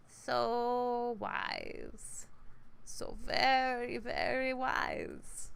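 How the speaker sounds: drawn out very slowly, mockingly; slow mocking praise. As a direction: stretch out the praise, sarcastic. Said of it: "is it, like, back in the throat?".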